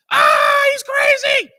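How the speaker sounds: high, loud mock scream; retold scream. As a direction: screech it like panicked big men. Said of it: "The two brothers start screaming".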